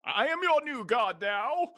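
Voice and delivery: very high, loud and slow; booming arrogant alien overlord. Acted. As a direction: proclaim it like a mad god. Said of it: "proclaims godhood".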